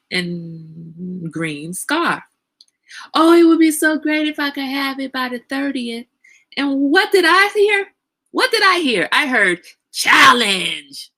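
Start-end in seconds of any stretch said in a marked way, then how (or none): 3.1-6.1 higher, lightly gushing wish; imitated wishful friend. Imitating a friend wishing for a scarf, lightly voiced wishfulness. 6.5-11.1 high, loud build-up to a punched word; hammed-up question, then a big announcement; dramatic reveal. Host reveals what she heard in friend's wish, dramatic build-up.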